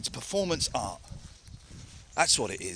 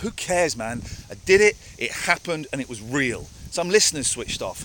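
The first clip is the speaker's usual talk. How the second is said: ordinary talk, nothing marked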